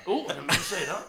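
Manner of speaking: mock-regretful character impression; Hagrid. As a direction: give a hint of a film character's accent. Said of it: "I was like Hagrid on Harry Potter".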